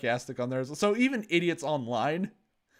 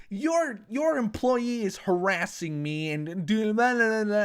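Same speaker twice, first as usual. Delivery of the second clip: mock whiny complaint; 6 semitones higher than usual. Karen complaining to owner.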